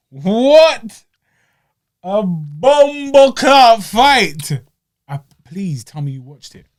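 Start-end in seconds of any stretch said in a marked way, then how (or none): 0.1-4.5 fight-announcer hype, slow and booming; hyped exclamation. Real, not acted. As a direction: build from drawn-out hype to a high, loud shout. Hyping up the fight to listeners.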